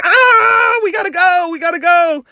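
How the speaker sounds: high, loud, urgent cry of "we gotta go"; excited shout. Retold or imitated. Students bolting out to chase a storm, shouting excitedly. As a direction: yell it like you're racing for the door. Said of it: "We're all like".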